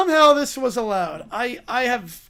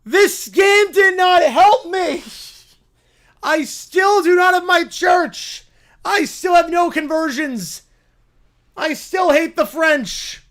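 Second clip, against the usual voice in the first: loud, melodramatic grievance list; shouted complaint; 4 semitones higher, 5 dB louder than usual. Acted. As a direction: rant it out theatrically. Acted character's loud complaint.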